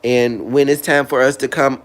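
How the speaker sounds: ordinary talk, nothing marked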